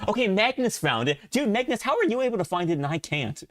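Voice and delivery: high-pitched, incredulous protest; exasperated disbelief. Real, not acted. Streamer asks friend how they found it, incredulous. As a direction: demand an explanation in a high voice.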